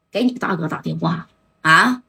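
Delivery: ordinary delivery, nothing marked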